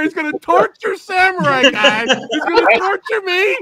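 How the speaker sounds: high, frantic retold reaction; losing it. Retelling their reaction to the film, losing it. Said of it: "I was already fucking losing it".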